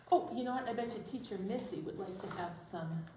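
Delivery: usual speaking voice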